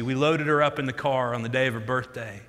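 ordinary talk, nothing marked

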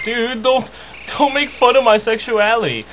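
high, whiny put-on protest; mock indignation. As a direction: protest like an offended character, hammed-up. Acts out the teased character.

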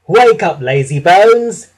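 high, loud holler; loud teasing wake-up call. A narrator's reading. Thomas startling tired Gordon, loud cheeky call. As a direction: rouse a sleeper cheekily, drawing out the end. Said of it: "whistled loudly".

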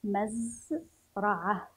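المزرعة split slowly as al, maz, ra'ah; syllable-by-syllable reading. Shown as a demonstration. Teacher helps pupils read, المزرعة slowly in parts. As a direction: pause between the parts of المزرعة. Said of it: "وتستطيعون القراءة".

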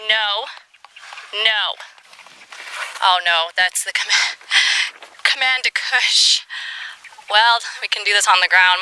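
normal talking voice

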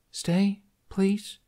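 high, pleading stay please; begging. A narrator's reading. Kamikaze holding his arms, begging Hiccup to stay. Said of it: "she begged".

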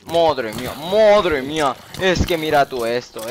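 loud, high panicked cry; panicked yelling. Real, not acted. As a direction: wail in alarm, drawn out. Overwhelmed by vampires at night.

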